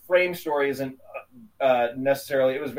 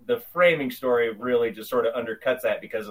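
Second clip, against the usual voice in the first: ordinary talk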